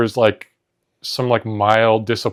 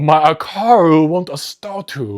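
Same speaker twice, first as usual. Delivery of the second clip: 1 mock Japanese; 6 semitones higher, slower than usual. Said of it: "That is not Japanese".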